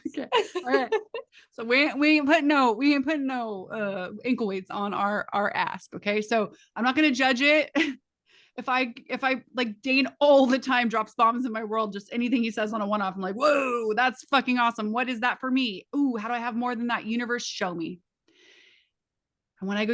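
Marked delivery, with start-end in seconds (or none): 1.6-5.9 loud, high mock-Southern twang; hammed-up Southern speech; Southern accent. Shown as a demonstration. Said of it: "I just became Southern". 13.3-18.0 louder, higher awestruck reaction; excited amazement. Retold or imitated. Acting out her amazed reaction.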